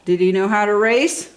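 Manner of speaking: ordinary delivery, nothing marked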